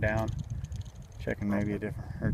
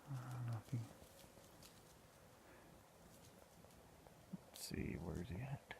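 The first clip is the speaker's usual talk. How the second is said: very soft, near-whispered murmur; barely audible, talking to yourself; hushed searching; 27 dB quieter than usual. Real, not acted. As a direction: keep your voice right down, as if watching a bird. Trying to spot a nearby grouse.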